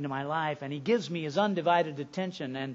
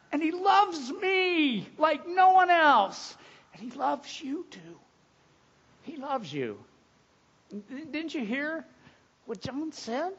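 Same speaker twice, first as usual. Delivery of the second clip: tearful; 11 semitones higher, 3 dB louder, slower than usual. Real, not acted. Pastor tearful after breaking down.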